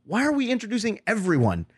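high-pitched mock enthusiasm; excited retelling. Retelling organisers' talk about intros, high and excited.

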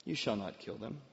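low, soft, measured ruling; calm refusal. Acted. Elisha answering the eager king, calm refusal. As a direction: quietly settle the matter.

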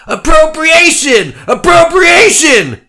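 very high, slow shrieking chant; screaming crowd. Acted. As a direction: shriek it like an angry mob. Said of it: "they screamed".

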